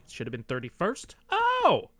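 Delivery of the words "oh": sudden high yelp of surprise; surprised gasp. Real, not acted. Gamer reacting to a rival's pick, startled high shout. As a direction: blurt it out, caught completely off guard.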